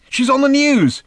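high, loud call to another room; urgent, high-pitched shout; excited shout. A narrator's reading. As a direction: yell it through the wall. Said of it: "shouted Arthur from the next room".

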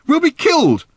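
very high, loud cry of alarm; alarmed protest. A narrator's reading. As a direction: protest in panic at a mad plan. Horrified objection to a reckless plan.

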